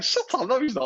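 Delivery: high, loud mock disbelief; retold disbelief. Imitating his mate refusing to believe the news, incredulous.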